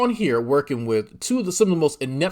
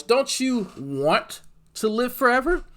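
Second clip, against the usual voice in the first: high, slow coaxing question, rising on "forever"; hammed-up invitation, pitch lifting at the end; mock preacher appeal; 12 semitones higher than usual. Streamer coaxing folks toward salvation, mock preacher question.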